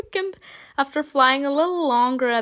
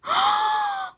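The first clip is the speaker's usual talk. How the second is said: high shocked gasp. Acted. Angel vanishes mid-fall.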